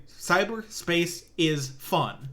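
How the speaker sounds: very slow, word by word; drawn-out, emphatic read of a caption; deliberate quote. Retold or imitated. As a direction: spell out an on-screen statement slowly. Quoting text put on screen.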